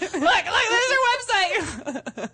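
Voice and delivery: loud, high, laughing exclamation; excited mock discovery. Husband showing friends what he found, excited mock discovery. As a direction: call it out high and drawn out through laughter. Imagined stranger recognising her, excitedly.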